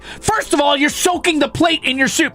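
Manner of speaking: high, shouting, indignant tirade; exasperated rant. Real, not acted. Streamer ranting at chat about soup on plates, shouting. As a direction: tear into the idea in outrage.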